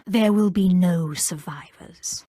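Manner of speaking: cold, breathy whisper of a killer; menacing whisper. Acted. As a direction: whisper it like a threat. Companion voice line, unvoiced delivery.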